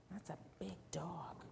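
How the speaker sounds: soft, whispery remark; hushed aside. Real, not acted. Hushed aside about the picture.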